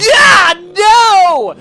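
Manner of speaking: very high, loud comic howl; frustrated scream. Retold or imitated. Storyteller refused a make-out by Rachel, hammed-up scream. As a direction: wail it out in mock outrage. Acted outburst at her refusal.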